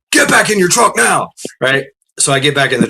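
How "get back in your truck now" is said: high, urgent retold command; sharp trooper's order; barked order. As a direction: imitate a cop ordering someone back.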